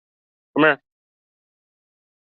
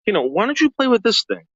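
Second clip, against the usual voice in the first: dog talk; 10 semitones higher than usual. Real, not acted. Coaxing dog to play.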